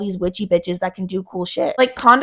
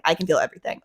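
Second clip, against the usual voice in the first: softer, defeated repeat of everything; quieter repeat; 5 semitones lower, 6 dB quieter, much faster than usual. Retold or imitated. Sensory-overwhelmed girl murmurs to herself, softer and sinking. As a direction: let it sink, drop to a murmur. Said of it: "I'm just like".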